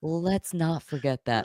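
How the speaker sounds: measured, hushed restatement; slow deliberate repeat. Real, not acted. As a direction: draw it out quietly for effect. Repeats the line after laughing.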